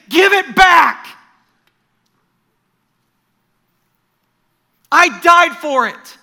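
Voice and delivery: loud, high pleading command, slowly; intense demand, not angry. Retold or imitated. Jesus to Graham in a dream, loud intense plea. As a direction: demand it back with love, not rage. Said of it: "Remember, not anger in the voice".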